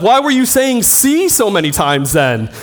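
a stunned friend demanding why; incredulous roommate. Retold or imitated. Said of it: "He's like".

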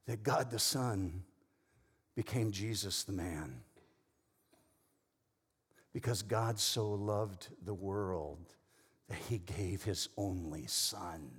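slow, low, soft solemn delivery; hushed reverence. Real, not acted. Preacher telling church God gave his Son, hushed reverence.

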